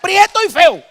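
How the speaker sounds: high, loud, slow laughing demon taunt; mocking demon insult. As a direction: jeer it like a cheeky demon. Preacher repeats demon's insult.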